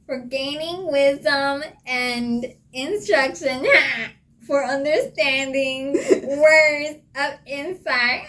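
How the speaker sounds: high, slow nasal-free Fran impression through laughter; Fran from Friends. Reading a Proverbs verse to a friend as Fran, high and slow. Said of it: "my accent is Fran from Friends".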